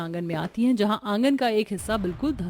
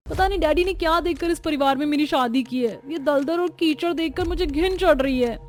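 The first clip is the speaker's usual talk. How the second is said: disgusted complaint; 5 semitones higher than usual. Acted. Bride disgusted by the mud.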